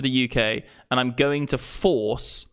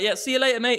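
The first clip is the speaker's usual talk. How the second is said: dismissive customer; 11 semitones higher than usual. Acted. Acting out customers deserting a seller.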